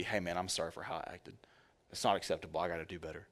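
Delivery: low, soft and contrite; soft apology. Retold or imitated. Electrician quietly apologizing to his coworker after an outburst. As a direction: say sorry quietly, humbled. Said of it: "I'd be".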